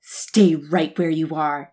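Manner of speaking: lightly voiced husky growl; a hint of a low growl. A narrator's reading. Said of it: "nothing more than a husky growl".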